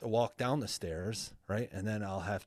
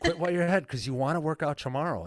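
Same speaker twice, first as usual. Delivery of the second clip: loud, high imitation of an insistent wife; retold telling-off, pushed up loud; wife's warning; 7 semitones higher, 6 dB louder, faster than usual. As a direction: raise pitch and volume to mimic her order. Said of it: "my wife comes out to the gym".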